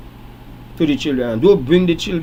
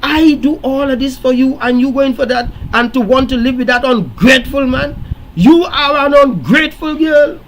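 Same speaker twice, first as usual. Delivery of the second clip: angry mother; 7 semitones higher than usual. Retold or imitated. Mother in the office berating her daughter, high angry scold. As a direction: berate the ungrateful girl in a high raised voice. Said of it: "The mother was says".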